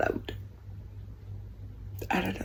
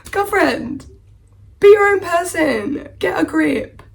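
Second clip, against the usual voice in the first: higher, louder, sassy talking-to; sassy advice to heroine; 9 semitones higher, 5 dB louder, slower than usual. Retold or imitated. Telling the book's heroine off, sassy and higher. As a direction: lecture her like a fed-up best friend. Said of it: "but I'm just like".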